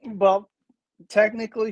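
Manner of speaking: usual speaking voice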